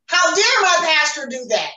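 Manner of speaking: loud, high indignant exclamation; mock outrage. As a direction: burst out as if scandalised. Mock outrage at pastor's sermon.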